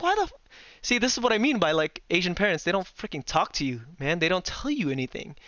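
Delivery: ordinary talk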